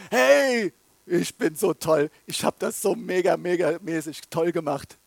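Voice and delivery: loud mock brag; proud believer brags about his own fruit; boastful bragging. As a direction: puff yourself up, showing off. Mocks a proud self-praising believer.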